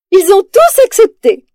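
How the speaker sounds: high, loud and overjoyed; delighted exclamation. Acted. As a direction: burst out with happy news. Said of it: "s'exclame la reine ravie".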